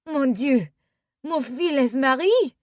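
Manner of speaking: French exclamation. Acted. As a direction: blurt it in French, near tears. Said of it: "she's about to cry".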